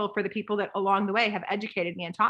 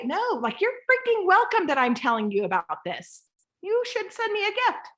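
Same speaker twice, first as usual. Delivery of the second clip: cocky; 11 semitones higher than usual. Shown as a demonstration. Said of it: "no timid; cocky attitude".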